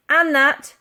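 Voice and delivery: loud, slow, high policeman's call; officer through door. A narrator's reading. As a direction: drag it out smugly through the door.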